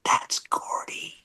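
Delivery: slow, breathless whisper, hardly a sound; mouthed whisper. Retold or imitated. Cellmate silently warning about Gordy, whispered slowly. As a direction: mouth it across the yard, unvoiced. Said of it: "Paul's mouthing to me".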